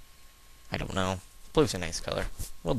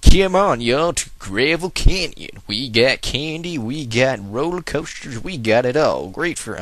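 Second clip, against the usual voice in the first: theme park ad; 4 semitones higher, 7 dB louder than usual. Pretend. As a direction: sell the park like a cheesy commercial. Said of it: "sounds like some really lame theme park".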